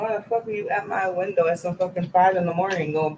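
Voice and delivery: ordinary delivery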